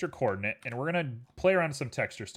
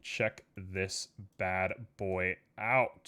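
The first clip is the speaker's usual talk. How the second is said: lower, very slow and quiet; low slow showoff; 6 semitones lower, 5 dB quieter, much slower than usual. Acted. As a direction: drawl it like a showoff.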